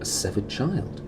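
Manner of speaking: slipped out hushed. Acted. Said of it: "knew this was something that shouldn't have been voiced".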